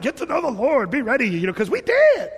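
high, laughing imitation of a carefree driver; high, amused voice of a man at peace; mimicking the driver. Pastor imitating a YouTube driver telling his wife.

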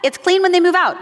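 high, loud, flustered comeback; exasperated retort. Retold or imitated. Wife firing back at her logical husband, high and exasperated. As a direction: bluster back at him in mock protest. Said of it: "And I would say".

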